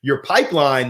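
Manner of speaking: ordinary talk, nothing marked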